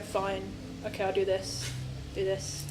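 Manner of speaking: a hint of a glum, put-upon underling; miserable stoic demon. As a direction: sigh it out like a demon sick of his job. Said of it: "the most miserable, stoic demon who just goes around going".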